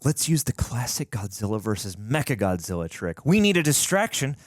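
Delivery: high, eager character read; hammed-up enthusiastic heroine; excited. Reading Adelia's scripted dialogue.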